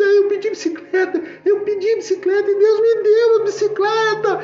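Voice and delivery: high-pitched wailing kid imitation; overjoyed tearful child. Child telling of the bicycle received, crying out in joy. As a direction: mimic a child bursting with emotion. Child rejoices over answered prayer.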